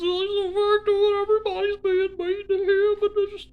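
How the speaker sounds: high whiny victim voice; mock victim. Mocking Square playing the victim, high whine. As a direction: whine like a put-upon crybaby.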